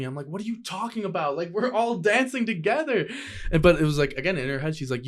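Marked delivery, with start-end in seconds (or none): 0.3-3.1 high-pitched incredulous protest; retold exasperation. Arguing back to the ex on the dancefloor, exasperated. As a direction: act out defending yourself, pitch climbing.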